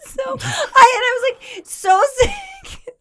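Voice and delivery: high, squeaky talk through laughter; words dissolving into laughter; cracking up. Real, not acted. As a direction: try to carry on while giggling. Cracking up while telling story.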